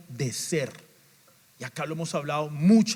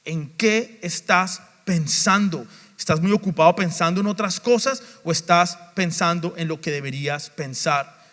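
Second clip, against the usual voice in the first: preaching challenge; 6 dB louder than usual. Acted. Challenges congregation directly.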